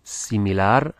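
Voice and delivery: "Similar" said as Spanish, see-mee-LAR; Spanish pronunciation. Shown as a demonstration. Teacher models words for learners to notice, Spanish pronunciation. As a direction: stress the last syllable of "Similar", the Spanish way. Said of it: "pay attention to the pronunciation".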